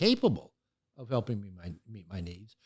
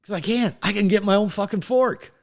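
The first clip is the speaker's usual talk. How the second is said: rising, louder, defensive retort; irritated refusal; 8 semitones higher, 7 dB louder than usual. Retold or imitated. Husband snapping at his wife over fetching a fork, louder and higher. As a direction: push back hard, insulted at being helped. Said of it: "usually I would say".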